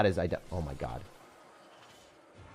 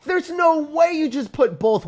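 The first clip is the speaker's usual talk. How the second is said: much higher, louder, slow cry of disbelief; outraged shout; 11 dB louder, much slower than usual. Real, not acted. Gamer raging at the opponent's double witch deck, outraged shout. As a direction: howl at the unfair play.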